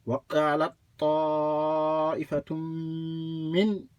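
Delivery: very long "طَا" and humming held M into "مِّنْ"; joined recitation. Shown as a demonstration. Teacher showing all joined together, prolonged shadda recitation. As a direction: link "وَقَالَتْ طَائِفَةٌ مِّنْ", doubling ط and م. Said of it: "if you join all together".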